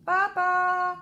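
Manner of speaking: high, loud call; boy calling papa after spilling water; child shouting. A narrator's reading. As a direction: yell it from the wet bed. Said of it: "crie Alphonse".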